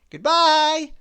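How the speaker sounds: high, loud jolly character voice; cheery farewell. A narrator's reading. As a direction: call goodbye brightly and high. Mister Happy calls farewell back.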